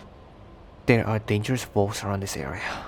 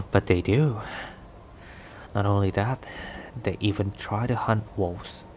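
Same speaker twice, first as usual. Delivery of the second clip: ordinary talk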